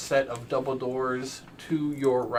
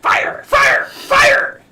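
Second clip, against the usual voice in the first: very high, loud creature shouts; manic skull shrieking; 14 dB louder, much slower than usual. Acted. Flame skull summoning up fire, shrill manic shrieks. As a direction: shriek each word like a crazed skull.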